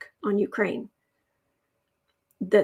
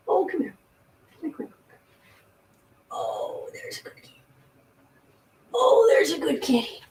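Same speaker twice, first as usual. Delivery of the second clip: cooing to cat; 5 semitones higher, faster than usual. Real, not acted. Owner coaxing her cat to come over. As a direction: coax the kitty gently.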